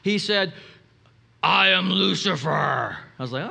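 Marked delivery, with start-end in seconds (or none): 1.4-3.0 low, slow, menacing demonic voice; guttural demonic voice. Retold or imitated. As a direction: growl it like a possessed man. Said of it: "in a guttural, demonic voice".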